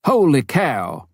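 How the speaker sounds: lightly voiced booming missionary exclaiming; American exclamation. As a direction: relish it like a big-hearted American doctor. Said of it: "punctuated with exclamations like".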